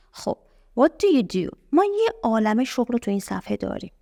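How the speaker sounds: ordinary delivery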